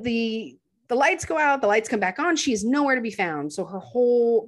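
ordinary talk, nothing marked

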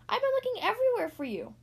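high, excited quacky voice; quacking monster. A narrator's reading. Costumed Thistle has been searching for friends, high quack. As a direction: squeal it with relief. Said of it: "swamp monster quacked".

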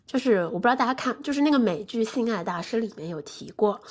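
normal talking voice

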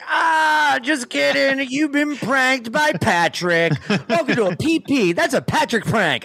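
high, hammy prank reveal; spring the prank like a goofy host; gleeful prankster. Pretend. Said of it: "You're like".

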